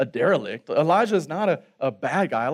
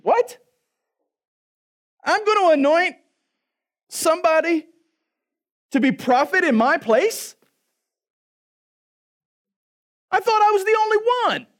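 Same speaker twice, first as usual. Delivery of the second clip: far higher, louder, squeaky disbelief; shocked Elijah; 9 dB louder than usual. Acted. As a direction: play Elijah stunned and hurt. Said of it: "Let's rewind".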